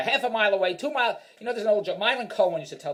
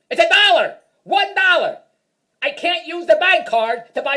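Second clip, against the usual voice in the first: much higher and louder, indignant; comic rants to audience about the price, indignant shout; outraged shout; 11 semitones higher, 9 dB louder, slower than usual. Real, not acted. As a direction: yell it like you can't believe the price.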